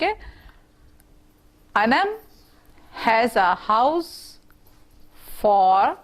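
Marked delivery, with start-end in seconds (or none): 1.7-6.0 slow reading aloud. Shown as a demonstration. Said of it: "आप इसे मेरे साथ साथ पढ़ें".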